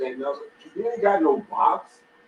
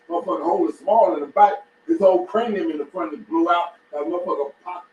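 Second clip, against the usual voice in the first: ordinary delivery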